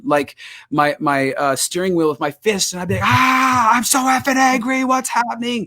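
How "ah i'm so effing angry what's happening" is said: raised high, yelling the frustration out; angry scream. Retold or imitated. Alone in his car, venting at God, a high retold yell. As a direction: vent like pounding the steering wheel. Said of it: "I would scream at the top of my lungs".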